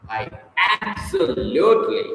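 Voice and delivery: ABSOLUTELY drawn out high, stress on each syllable; stress on every syllable. Shown as a demonstration. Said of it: "lay stress on every single syllable".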